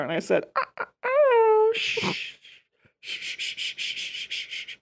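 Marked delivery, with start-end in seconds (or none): 0.6-1.7 mock sipping noises. 1.7-4.8 soft unvoiced slurps through a straw; slurping a Shirley Temple during the ceremony; slurping sip noises. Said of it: "That's me sipping on my Shirley Temple".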